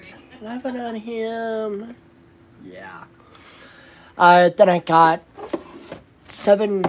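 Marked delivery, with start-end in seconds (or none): 0.0-1.9 slow, higher cooing voice. Real, not acted. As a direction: coo it slowly. Cooing over the pets.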